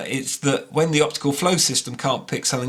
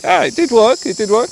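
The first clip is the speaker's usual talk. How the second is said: high, loud delighted surprise; thrilled it finally worked; excited; 13 semitones higher, 6 dB louder than usual. Real, not acted. Gesture mode suddenly works.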